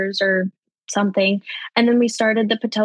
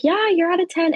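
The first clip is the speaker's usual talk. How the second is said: pitched-up casual announcement; breezy news from the nurse; high matter-of-fact nurse; 8 semitones higher than usual. Retold or imitated. Nurse telling the mother she is at ten, pitched up.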